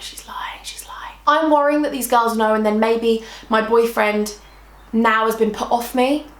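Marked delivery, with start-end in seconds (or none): 0.0-1.2 hushed inner voice; voiceless whisper to oneself; whispered thought. Retold or imitated. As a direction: whisper it as a private suspicion. So in my head, I'm thinking.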